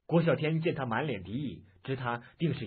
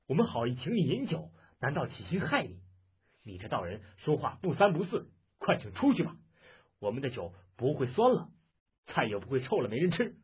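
indignant, pitched up at the start; agitated outburst. Acted. Host to the suspicious Taoist guest, an agitated angry outburst. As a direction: flare up in impatient annoyance. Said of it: "杨铁心更是焦躁，发作道".

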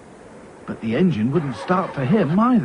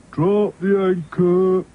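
slow, dragging, droopy repeat; Sad Sack echoing; 8 semitones higher, 4 dB louder, much slower than usual. A narrator's reading. Sad Sack repeats the captain's order, slow and dragging. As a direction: drag the words out glumly. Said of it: "said Sad Sack".

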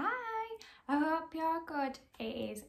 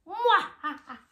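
loud hammed-up villain cackle; evil laugh; 5 semitones higher, 9 dB louder than usual. The evil pea cackling at the lost veggies, loud. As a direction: cackle like a cartoon baddie.

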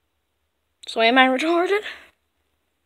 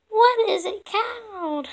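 higher, slow character question; 9 semitones higher, slower than usual. Acted. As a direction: ask it up high, slowly. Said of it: "I mean".

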